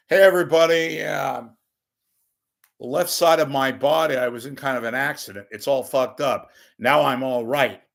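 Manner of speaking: shouting out a corny pun to the room; loud punchline delivery. Shown as a demonstration. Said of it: "Here, it goes like this".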